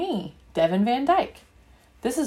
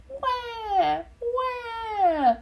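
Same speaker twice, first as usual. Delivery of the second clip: much higher, far off newborn cry; baby wailing; 12 semitones higher than usual. Acted. A newborn crying at her new family, high wailing. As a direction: wail like a tiny dragon. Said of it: "sounds like a little dragon".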